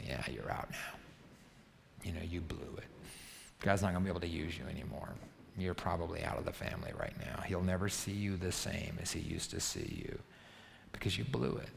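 low, soft, sneering accuser; accusing voice in your ear. Retold or imitated. Pastor voices people whispering that you blew it, low and sneering. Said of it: "people like to get in your ear and say".

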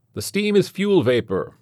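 calling out an answer. A narrator's reading. Goddard answers a question from the crowd, calling out. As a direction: project the answer over a crowd. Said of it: "answering a question thrown to him from the crowd".

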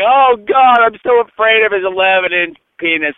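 high, hammed-up fearful gasp; mock the scared victims in a high voice; mock frightened victims. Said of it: "they're all like".

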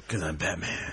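low, quiet gravelly growl; gravelly Batman voice. Pretend. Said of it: "said something in a gravelly voice".

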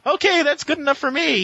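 high-pitched acted reply; mock eager reporter. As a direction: voice the pleased character up high. Voices a character in recap.

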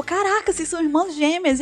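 high-pitched imitated astonishment; amazed friend. Friend believing the twin lie, amazed. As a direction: play the friend falling for it.

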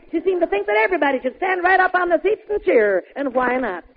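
ordinary talk, nothing marked

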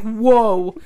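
loud startled whoa; shocked whoa. Retold or imitated. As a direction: react stunned and loud. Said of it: "I'm like".

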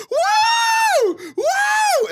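put-on loud, very high party-girl whoop; cheering woo. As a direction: whoop like excited girls at a strip show. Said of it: "the girls going like".

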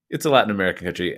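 usual speaking voice

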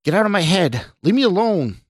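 high, agitated protest; shouting. A narrator's reading. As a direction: cry out to be left alone. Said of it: "Scott shouted".

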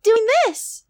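put-on high cutesy e-girl voice; teasing Discord kitten voice. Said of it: "What if I spend the rest of the episode doing this".

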